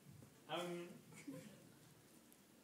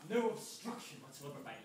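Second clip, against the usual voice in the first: ordinary talk, nothing marked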